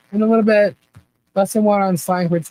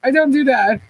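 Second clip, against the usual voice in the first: ordinary talk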